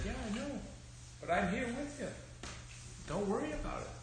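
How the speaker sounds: high, soft comforting voice; soft, lifted voice of a comforting father; gentle reassuring dad. Acted. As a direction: soothe a scared child, tender and hushed. Dad reassures his scared daughter.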